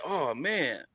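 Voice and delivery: soft, let-down sigh of words; mildly deflated, quoting her softly; retold disappointment. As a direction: imitate her quiet disappointment. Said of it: "she was all disappointed".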